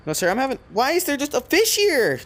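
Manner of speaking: suddenly high, loud bewilderment; surprised outburst. Real, not acted. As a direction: voice jumps high and loud in disbelief. Sudden fish appears in game.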